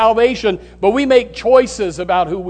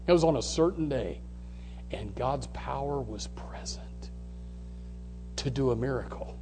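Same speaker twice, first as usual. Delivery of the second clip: very soft, low preaching near a whisper; hushed awe; 6 semitones lower, 17 dB quieter than usual. Real, not acted. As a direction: let it sink to a hush, with pauses. Hushed retelling for dramatic weight.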